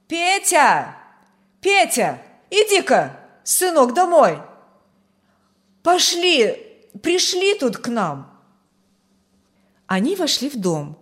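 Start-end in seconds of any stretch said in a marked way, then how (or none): 0.1-8.3 high, loud call across a garden. A narrator's reading. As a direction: shout for your son to come home. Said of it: "И крикнула куда-то в огород". (громко зовёт издалека)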